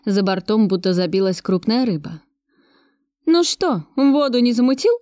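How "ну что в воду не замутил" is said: a mocking smirk, higher. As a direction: tease him with a grin in the voice. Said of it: "усмехнулась я".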